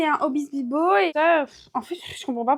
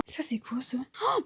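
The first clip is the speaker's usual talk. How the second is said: whisper; 9 dB quieter, slower than usual. Real, not acted. Excited discovery of old trousers.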